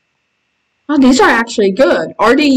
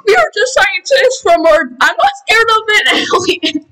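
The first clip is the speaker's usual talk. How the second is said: mock fright; 10 semitones higher than usual. Meeting an alien in game.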